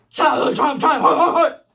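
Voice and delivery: loud, building to a high chant; mock announcer shout. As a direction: blare it out like a hammed-up title. Mock announcement of trauma story.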